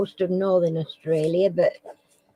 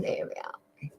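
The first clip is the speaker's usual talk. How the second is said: breathy whispered aside; host whispers to himself; 12 dB quieter than usual. Real, not acted. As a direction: murmur it under your breath.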